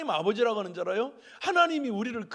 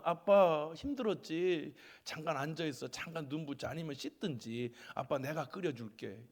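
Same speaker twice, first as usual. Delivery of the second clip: tender; 5 semitones lower, 6 dB quieter than usual. Retold or imitated. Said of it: "띵동 오고 들어오면".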